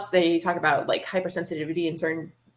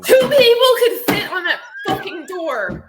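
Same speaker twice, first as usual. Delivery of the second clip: loud, laughing outburst; laughing shout; 12 semitones higher, 8 dB louder than usual. Real, not acted. Shouting laughingly about movie.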